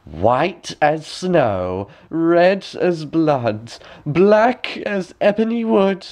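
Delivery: very slow, rising evil chant; slow rising triumphant chant; wicked queen gloating. A narrator's reading. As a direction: savour each word like a curse. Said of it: "laughed aloud, and said".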